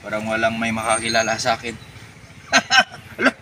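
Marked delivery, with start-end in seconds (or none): 2.5-3.1 high evil cackle; short cartoon villain cackle; villain laugh. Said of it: "Ba't yung tawa ko pang kontrabida".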